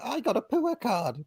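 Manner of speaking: put-on high-pitched little-girl parroting; child copying Welsh dad. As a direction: mimic a five-year-old copying a Welsh 'poor'. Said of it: "she has started copying".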